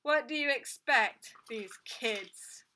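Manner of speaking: gruff grumble, loud then dropping away; grumpy man's growl. A narrator's reading. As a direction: growl it out, trailing to a rasp. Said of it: "the other man growled".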